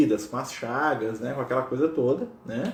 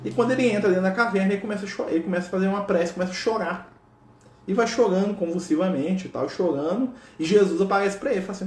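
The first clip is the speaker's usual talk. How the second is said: ordinary delivery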